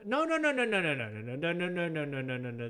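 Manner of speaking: run-on string of no's, sliding down; emphatic refusal. Real, not acted. Rejecting a wrong pronunciation, emphatic refusal.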